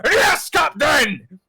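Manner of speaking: slow, high, loud yell; monster crewman shout. Acted. Creature crewman shouting back to the captain. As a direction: bark back like a loyal brute.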